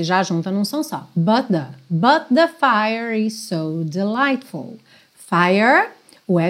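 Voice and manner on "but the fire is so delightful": "but the" run into one sound, slow; linked but the. Shown as a demonstration. Teacher modeling linked "but the" in the lyric. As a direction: glide "but the" together. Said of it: "but com the".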